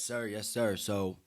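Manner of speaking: ordinary delivery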